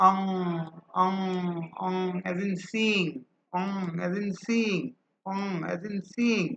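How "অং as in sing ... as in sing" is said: drawn-out "অং as in sing", nasal ending held; slow model pronunciation. Shown as a demonstration. Teacher drilling the sound for students, slow and clear. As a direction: stretch "sing" slowly for the class to repeat. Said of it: "এটা হচ্ছে অং".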